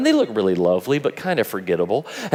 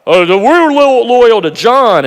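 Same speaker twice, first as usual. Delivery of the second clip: defensive loyalty; 10 semitones higher, 10 dB louder than usual. Retold or imitated. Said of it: "and they're like".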